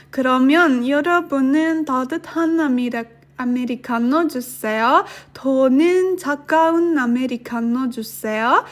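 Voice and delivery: rattled off fast like a native speaker; quick natural speed for practice. Shown as a demonstration. Teacher to students reading the sentence fast at native speed. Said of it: "one time faster and one time slower".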